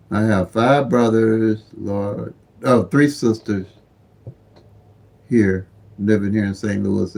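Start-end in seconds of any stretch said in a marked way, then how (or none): none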